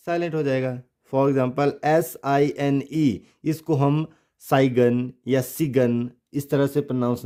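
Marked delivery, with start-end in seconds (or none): 4.4-5.1 साइगन with a hard g before the n; mispronounced "sign" as साइगन; wrong, g sounded. Shown as a demonstration. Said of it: "नहीं करेंगे". 5.4-6.1 सिगन with short i and hard g; mispronounced "sign" as सिगन; wrong, g sounded. Shown as a demonstration. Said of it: "नहीं करेंगे".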